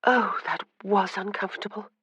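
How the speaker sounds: a weary, sighed complaint; sighing. Acted. Anna sighs to her sister in the carriage, weary. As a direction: let it out on a tired sigh. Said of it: "Anna said with a sigh".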